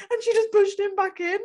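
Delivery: high, loud disbelieving burst; shocked punchline. Real, not acted. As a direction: blurt out the shock.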